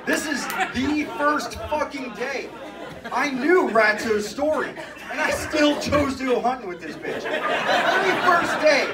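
loud, high, emphatic comic outrage; exasperated rant. Real, not acted. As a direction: hammer each phrase in disbelief. Exasperated rant about first day.

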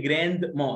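جراند ما in two parts with an audible D; British pronunciation. Shown as a demonstration. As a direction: say جراند ما British-style with the D sounded. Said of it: "في البريتش بنقول".

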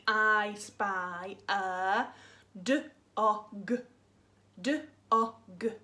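slow drawn-out I spy; slow game call. Shown as a demonstration. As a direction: stretch out I spy slowly. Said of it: "I'll say something like".

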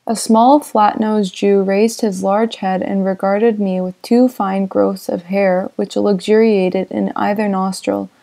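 usual speaking voice

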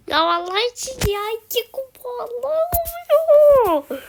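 high wailing complaint over lost trophies; wailing plea. Real, not acted. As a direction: cry out to God, begging. Losing trophies, pleading to God.

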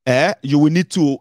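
usual speaking voice

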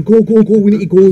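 loud, high, urgent urging; retold panicky getaway yell; urgent shout. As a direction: shout to hurry the driver along. Urging taxi driver to go.

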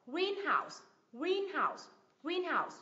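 "greenhouse" as GREEN-house, GREEN high and stretched; teacher's model of "greenhouse", weight on GREEN; stress on first syllable. Shown as a demonstration. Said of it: "nhấn vào thành phần thứ nhất".

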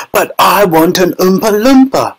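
high-pitched tantrum cry; shrieked insistence, pitch climbing; Veruca Salt. A narrator's reading. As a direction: scream a demand like a spoilt child. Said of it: "screamed Veruca".

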